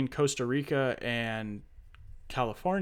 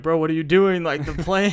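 louder, higher mock call to the pilot; alarmed call-out; 4 semitones higher, 7 dB louder than usual. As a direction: call out in disbelief. Calling out to the pilot.

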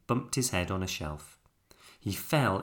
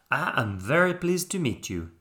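put-on higher French-accented bear voice; 6 semitones higher, 4 dB louder than usual. A narrator's reading. Bear meeting the toys, polite French accent.

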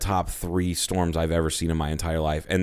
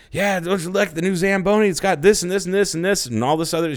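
high, put-on salesman voice; mayor bragging; 11 semitones higher than usual. Mayor bragging to the wife about the Zamboni in a high put-on voice. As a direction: show off the new toy proudly. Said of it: "he's like".

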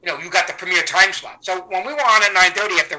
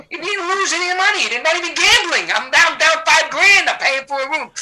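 high, loud, exasperated complaining; indignant rant; 12 semitones higher, 6 dB louder than usual. Acted. A son griping about his father's free room, indignant and high. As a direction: complain in outrage about the unfairness.

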